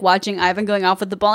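usual speaking voice

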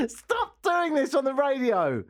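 helpless giggling through words; can't stop laughing, high squeals; hysterical laugh. Real, not acted. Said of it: "I've got the giggles".

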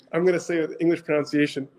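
usual speaking voice

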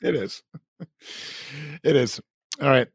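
ordinary delivery

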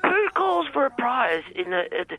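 high-pitched, incredulous complaint; narky. Acted. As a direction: demand to know who'd call at this hour. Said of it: "don't get narky at me".

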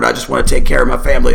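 low, fast grumpy grumble; a lightly voiced gruff rancher; grumpy Harrison Ford. Grumpy patriarch grumbles about his family, low. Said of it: "grumpy Harrison Ford".

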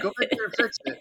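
high put-on voice; Christina Mandrell. Co-host imagines a contestant sending her back, in a high mocking voice. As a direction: imitate a meddler ordering her back. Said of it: "It was probably Christina Mandrell was like".

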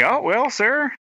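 put-on high-pitched country accent. Talking to a country gentleman about mowing, country accent slipping out. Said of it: "my accent comes out, like, terribly".

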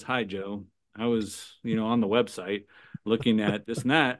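low, subdued customer voice; low-key caller. Acted. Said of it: "And the person goes".

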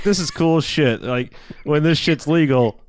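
a dazed tourist savoring his weed; stoned dude. Retold or imitated. Stoned tourist voiced in retelling.

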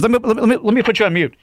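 mock the rep fumbling to get away; flustered phone rep. Phone rep putting customer on mute, mock flustered.

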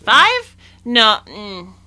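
very high, incredulous questioning; disapproving. Retold or imitated. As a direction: grill the parent in disbelief. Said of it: "and I was like".

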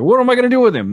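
exasperated outburst. Retold or imitated. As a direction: throw up your hands in disbelief. Exasperated retort in retold argument.

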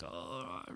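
a weak groan of pain, barely voiced. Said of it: "she says I was stuttering".

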